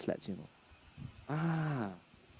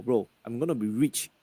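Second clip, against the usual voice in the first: usual speaking voice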